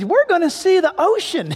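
put-on high, eager kid's voice; excited little boy. Boy on a plane announcing the beach trip, excited. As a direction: pipe up like an excited five-year-old. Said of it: "he said".

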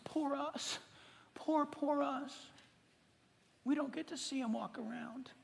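soft, whiny poor-us voice, fading to a whisper; mock self-pity. Preacher mocking churchgoers' complaints, whiny and soft. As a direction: mope like a sorry victim. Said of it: "we have the tendency to go".

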